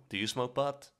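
ordinary delivery

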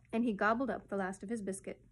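usual speaking voice

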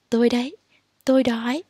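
normal talking voice

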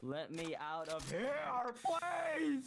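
soft plea leaping high at the end; mock desperate plea. Jailed player begging to be let out, mock desperate. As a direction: plead quietly, voice rising sharply on the last word.

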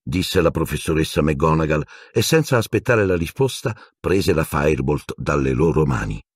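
ordinary delivery, nothing marked

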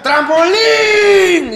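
high, long-drawn song request; drunken fan yelling out a request; mock heckler's shout. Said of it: "cada canción gritaba".

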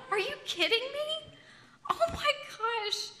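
laughing disbelief. Retold or imitated. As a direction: retell the shock while cracking up. Retold shock at pregnancy, laughing.

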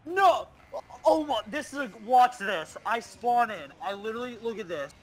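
excited. Real, not acted. Frantic reaction during a match.

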